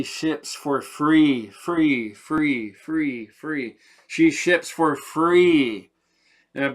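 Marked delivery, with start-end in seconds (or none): 0.8-3.8 drawn-out free. Acted. Stretching out free for emphasis. 4.1-5.9 She ships for free, slow, loud, leaning on "she"; emphatic repeat. Acted. Telling viewers she ships free, slow and louder.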